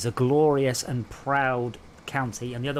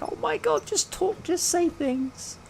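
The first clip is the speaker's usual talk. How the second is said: much higher, squealing with delight; amazed gushing; slower than usual. Retold or imitated. Telling the southern lady he loves her accent, high delighted gush. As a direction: gush like you're charmed by a voice, slowing at the end. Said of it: "I was like".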